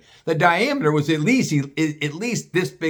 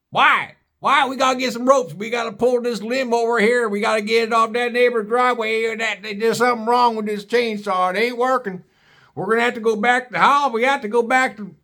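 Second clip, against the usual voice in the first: angry hillbilly father; 7 semitones higher than usual. Retold or imitated. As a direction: play Pappy blaming the chainsaw. Hillbilly Pappy voiced in story.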